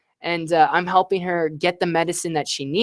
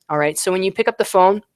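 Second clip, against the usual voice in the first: normal talking voice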